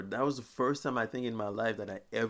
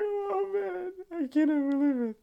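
much higher, whining fake cry; mock despair; 5 dB louder than usual. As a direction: wail it like a fake sob. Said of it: "I was like".

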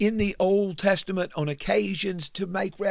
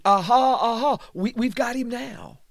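loud, higher mocking taunt; the trial crowd jeering loudly; gloating mockery; 5 semitones higher, 4 dB louder than usual. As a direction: crow over a caught enemy. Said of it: "many who said at his trial".

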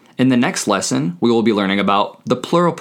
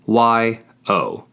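slow, letter by letter; spelled out. Shown as a demonstration. Teacher spelling a Spanish word for learners, spelled out slowly. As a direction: spell it out with a gap between letters.

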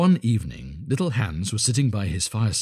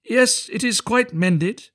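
high, slow, pleased reply; eager little Hans; 13 semitones higher, 5 dB louder, much slower than usual. A narrator's reading. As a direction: call back high and loud. Hans answers from the ladder.

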